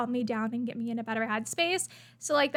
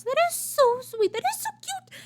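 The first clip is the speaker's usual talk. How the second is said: very high, cooing delight; real high-pitched adoration; adoring squeal; 12 semitones higher, 4 dB louder than usual. Real, not acted. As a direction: gush in a rising squeal over kittens. Gushing over cat picture.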